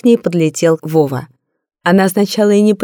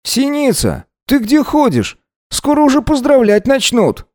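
higher, annoyed guy on the phone; displeased young man; 7 semitones higher than usual. Acted. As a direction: grumble at her, pitched up. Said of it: "Раздался недовольный голос Вовы".

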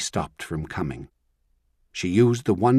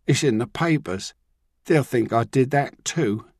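sulky; 5 semitones higher, 3 dB louder than usual. Acted. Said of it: "He sounded sulky".

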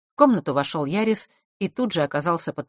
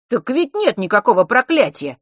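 higher, louder puzzled protest; Ярис, bewildered; 6 semitones higher, 6 dB louder than usual. A narrator's reading. Boy puzzled by his partner's worry, bewildered. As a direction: object in honest bewilderment, voice lifted. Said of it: "продолжал недоумевать Ярис".

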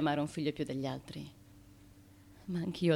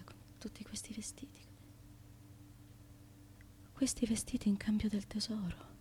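hushed, lonely whisper; whispered to herself; 2 semitones higher, 9 dB quieter than usual. Acted. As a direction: whisper alone in the dark. Left alone, talking to herself.

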